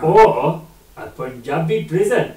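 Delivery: slow, drawn-out fake Indian accent. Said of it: "you did the voice".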